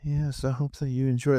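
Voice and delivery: lightly voiced dazed co-host, acting half asleep; a hint of a zoned-out co-host; imitating the co-host. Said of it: "he talks like this".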